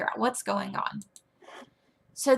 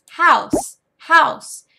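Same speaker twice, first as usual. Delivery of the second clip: loud, high model word, repeated; emphatic teacher repetition; repetition drill; 7 semitones higher, 11 dB louder than usual. Shown as a demonstration. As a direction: punch each word out for the pupil to copy. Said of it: "Make them say it several times".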